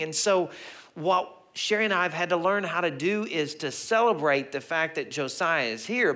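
ordinary delivery

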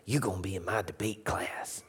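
low, hushed Cajun teacher; quietly decree it in a Cajun accent; soft Cajun order, no argument allowed; Miss Billingsley. Retold or imitated. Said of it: "She had a strong Cajun a-, accent".

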